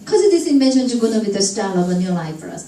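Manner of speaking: unbroken, falling stream from "Cause" to "for us"; run-together monotone down to "for us"; long linked sentence. Shown as a demonstration. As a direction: reel off "Cause this invention" onward without gaps. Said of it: "긴 문장 나오고 연음까지".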